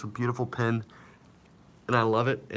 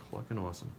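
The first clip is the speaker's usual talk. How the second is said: quiet repeat; 9 dB quieter, faster than usual. Shown as a demonstration.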